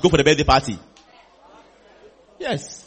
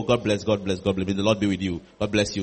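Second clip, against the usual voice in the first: low, soft pastoral blessing; murmured blessing; 9 semitones lower, 7 dB quieter than usual. Acted. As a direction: bless them gently under your breath, dropping low. Shepherd blessing a church member.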